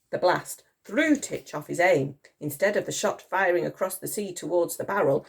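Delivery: ordinary delivery, nothing marked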